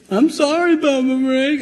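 put-on high little-boy voice, pleading; child's apology. Grandson pleading with Papa Frank, high child voice. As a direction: squeak it out like a sorry small boy.

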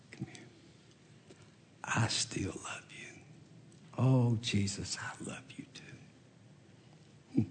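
soft, breathless whisper of love. Retold or imitated. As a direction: whisper it as a private reconciliation. Jesus and Simon meet privately.

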